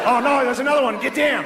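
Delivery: high-pitched Australian shout of alarm; mock-panicked Australian warning; Australian accent. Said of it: "an Australian guy in the office".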